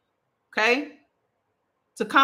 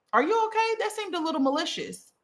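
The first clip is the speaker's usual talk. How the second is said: high, innocent questioning; sweet surface, pointed question underneath; curious concern; 11 semitones higher than usual. Shown as a demonstration. To someone throwing shots, high innocent questioning. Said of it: "And so I always say".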